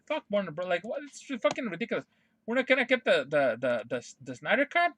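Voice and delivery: normal talking voice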